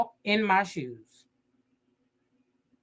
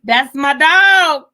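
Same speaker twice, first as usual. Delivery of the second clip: very loud, high, stretched-out holler; mock proud shout, long final word; hollered catchphrase; 9 semitones higher, 11 dB louder, much slower than usual. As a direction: belt it out slow like folks bragging. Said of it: "You know when they say".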